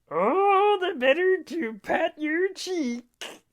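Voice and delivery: very high, slow fake granny falsetto; wolf as grandma. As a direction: stretch it out in a squeaky put-on granny voice. Wolf posing as grandma answers Fred.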